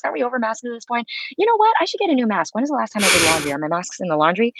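nervous chatter to yourself, all in one breath; rapid rambling. Shown as a demonstration. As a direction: spill every passing thought out at speed. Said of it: "when you just started talking like this really fast".

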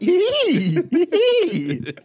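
high, loud mock squealing; exaggerated high-pitched "ee" squeals; schoolgirl squeal. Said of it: "since we sound like schoolgirls".

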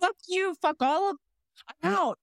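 high-pitched, fed-up kiss-off; mock angry quitting. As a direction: curse everyone off in playful exasperation. Acts out gatekeeper quitting in disgust.

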